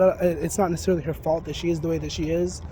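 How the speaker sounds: usual speaking voice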